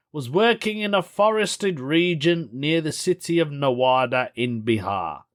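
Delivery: ordinary talk, nothing marked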